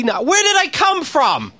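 high, slow ranting shout; exasperated shout. Retold or imitated. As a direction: rant at the system. Ranting at phone system.

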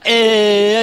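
loud, long held "uh"; mimicked filler "uh". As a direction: hold the "uh" to keep your turn. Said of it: "don't wanna lose my place in talk line".

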